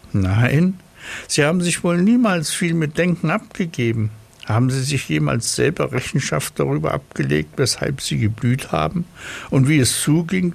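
high, fast, lecturing snail. Acted.

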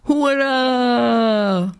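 long, high-pitched hurrah; cheering. A narrator's reading. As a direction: cheer it out, holding the last vowel. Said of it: "riefen alle".

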